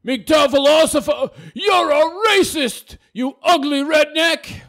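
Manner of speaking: slow, high, loud hammed-up shout; mock outraged accuser. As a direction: draw out each accusation at a high yell. Reads out an accusing comment.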